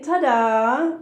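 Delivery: ordinary delivery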